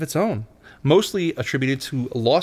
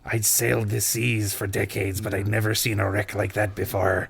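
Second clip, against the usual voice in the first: sea captain voice; 4 semitones lower, 5 dB quieter than usual. Pretend. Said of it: "a different sea captain voice to tell the story in".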